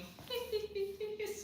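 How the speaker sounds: high, soft giggling; helpless little high-pitched titter; real giggle. Real, not acted. Host laughing at doctors who did not help, giggling. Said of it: "I am laughing at the doctors".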